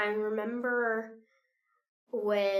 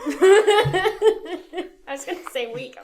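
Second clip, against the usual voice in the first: laughing through the words, higher and louder; 11 semitones higher, 11 dB louder, much slower than usual. Real, not acted. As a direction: giggle out the correction. Laughs at own slip.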